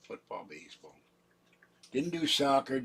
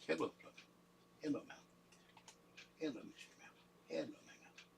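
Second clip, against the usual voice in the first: soft, higher pet-greeting voice; cooing to cats; 5 semitones higher, 15 dB quieter, faster than usual. Real, not acted. Owner greeting the cats, softly cooing. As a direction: coo hello to each cat.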